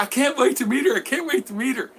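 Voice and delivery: high, sing-song eager lover; eager suitor. Retold or imitated. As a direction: gush it like a giddy man off the boat.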